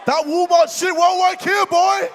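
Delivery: loud trash talk yelling; furious shout. Real, not acted. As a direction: roar it at your rival.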